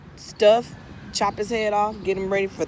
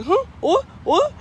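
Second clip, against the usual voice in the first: much higher, squeaky startled what; confused yelp, pitched way up; startled turkey; 11 semitones higher, 4 dB louder than usual. Acted. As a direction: jolt up in cartoon surprise. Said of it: "And he looks up like".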